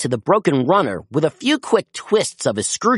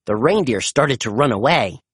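ordinary delivery

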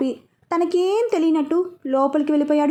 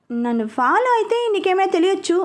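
teasing chuckle; 6 semitones higher than usual. A narrator's reading. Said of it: "అంటూ నవ్వుకుంటూ వెళ్ళిపోయాడు".

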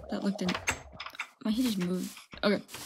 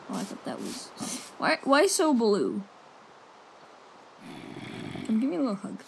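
normal talking voice

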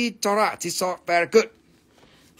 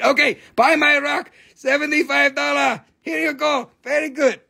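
higher, bouncy hawker voice; mock seller pitch; 6 semitones higher than usual. Seller hawks a rock to viewers, higher and bouncy. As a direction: hawk the rock in a raised pitch.

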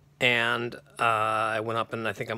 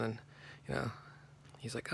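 normal talking voice

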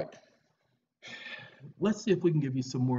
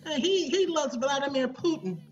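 mock voice; 14 semitones higher, 5 dB louder than usual. Mocking voice about Russian.

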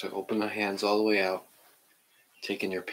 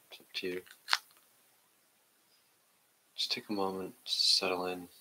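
guided meditation; 2 semitones lower, 4 dB quieter than usual. Acted. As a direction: ease the class into stillness, half whispering. Leads a seated meditation.

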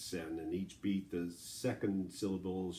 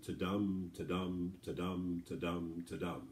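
ta-dum repeated evenly, each "dum" stronger than "ta"; heartbeat rhythm. Shown as a demonstration. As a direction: beat out ta-dum like a steady heartbeat. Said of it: "sort of a heartbeat rhythm".

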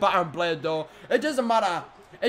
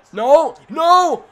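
a rising cry of no, louder each time; despairing shout; 6 semitones higher, 7 dB louder than usual. Real, not acted. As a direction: yell it as the match is lost. Watching the match slip away.

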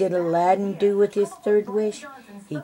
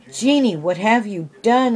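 higher, louder alarmed shout; 5 semitones higher, 8 dB louder than usual. A narrator's reading. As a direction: shout it at the genie in dismay. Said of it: "Aladdin shouted".

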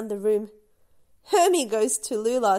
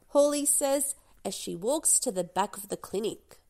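plain reading voice

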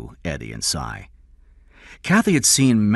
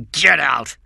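loud, higher angry yell; scream; 6 semitones higher, 5 dB louder than usual. A narrator's reading. King orders Cameron out, screaming. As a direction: yell him out of the room. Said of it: "King screamed".

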